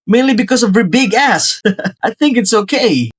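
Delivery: high, loud teasing with a laugh; cheeky laugh. Acted. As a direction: crack the crude joke and chuckle.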